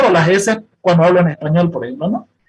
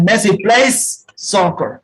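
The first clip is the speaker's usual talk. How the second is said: Messi PLAYS soccer, slow, hissing the s of plays; stressed final s; much slower than usual. Shown as a demonstration. Teacher modelling the final s for the class, slow and stressed. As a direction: draw out the s in plays. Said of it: "la consonante es plays".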